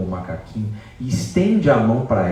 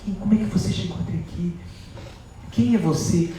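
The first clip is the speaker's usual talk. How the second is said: tearful Sita; 8 semitones higher, 4 dB quieter than usual. Acted. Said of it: "começa a chorar. Chora".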